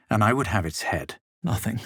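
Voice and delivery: usual speaking voice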